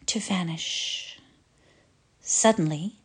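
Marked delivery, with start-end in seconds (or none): 0.1-1.2 trailing off to a whisper; fading whisper. A narrator's reading. Narrator letting the singing fade, whispering. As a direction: let the voice vanish into a whisper. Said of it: "The voices began to die".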